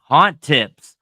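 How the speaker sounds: booming announcer title call. Acted. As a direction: call it out like a show host.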